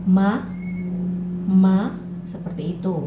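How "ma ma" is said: Ma rising and short; second tone Ma. Shown as a demonstration. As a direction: lift Ma quickly upward. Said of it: "naik dan dibaca pendek saja".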